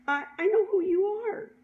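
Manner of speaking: high, emphatic imitation of someone recognizing you; acted-out excited realization; excited recognition. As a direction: exclaim it as it dawns on you. Acting out cashier's sudden recognition.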